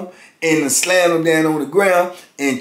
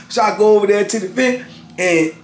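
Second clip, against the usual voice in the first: ordinary talk, nothing marked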